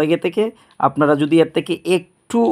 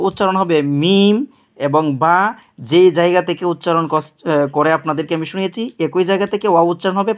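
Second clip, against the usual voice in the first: ordinary delivery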